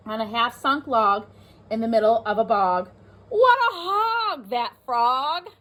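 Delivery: put-on lively read-aloud for young children; teacher reading a rhyming book to the class; storybook reading. A narrator's reading.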